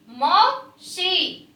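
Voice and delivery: मौसी drawn out very slowly, syllable by syllable; slow sounding out. Shown as a demonstration. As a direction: stretch मौसी slowly for the class. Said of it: "आप भी बेटा साथ साथ बोलेंगे".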